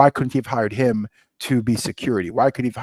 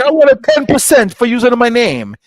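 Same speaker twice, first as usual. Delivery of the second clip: loud, higher-pitched dad demanding his cut; imitating demanding dad; 11 semitones higher, 8 dB louder than usual. Son quotes his dad wanting ten percent, demanding. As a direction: put on a pushy dad, louder and higher.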